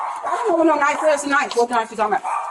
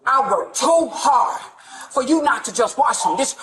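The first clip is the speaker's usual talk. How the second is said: normal talking voice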